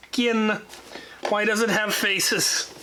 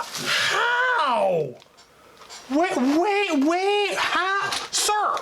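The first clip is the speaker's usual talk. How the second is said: high disbelieving wait, wait; stunned and high-pitched; surprised; 12 semitones higher, much slower than usual. Real, not acted. Stunned by gift.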